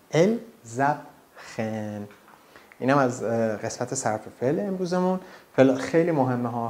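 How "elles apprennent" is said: "Elles apprennent" very slowly, broken up as el, zap, ren; liaison Z. Shown as a demonstration. Teacher breaking down the z link, very slow "Elles apprennent". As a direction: split "Elles apprennent" into pieces, z starting the second.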